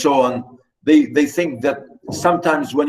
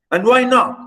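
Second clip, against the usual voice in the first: higher-pitched mild mocking retort; jeering; 8 semitones higher, 3 dB louder than usual. A narrator's reading. Katerina mocking her daughter's question, with a jeer. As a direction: toss it back as a light jeer. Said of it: "said Katerina Ivanovna with a jeer".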